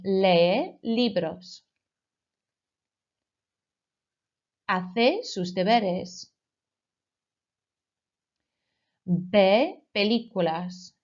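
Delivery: slow, clear phrases for pupils to repeat; slow model phrase. Shown as a demonstration. Teacher slowly modelling verbs for the class to repeat. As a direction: lay each word out slowly to be echoed. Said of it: "repeat after me".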